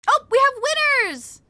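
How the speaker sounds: high, slow happy call; excited announcement. Real, not acted. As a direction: announce the winners with delight. Announcing giveaway winners.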